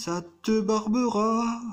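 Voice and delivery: high, slow put-on comic voice; drawn-out sketch-character line, played for laughs; Les Inconnus impression. Quoting a comedy sketch to his viewers, high slow comic voice. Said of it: "comme diraient les inconnus".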